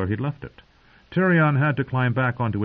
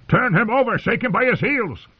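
bellowed order; 12 semitones higher than usual. Acted. Mace Tyrell bellows advice to the guards. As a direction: keep shouting into the chaos. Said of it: "Mace Tyrell bellowed".

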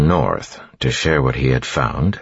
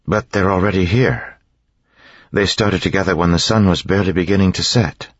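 higher voice, mildly insistent; insistent mistress; 6 semitones higher than usual. A narrator's reading. Mistress arguing with her servant about early mists, mildly insistent. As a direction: press the point in a lightly raised register.

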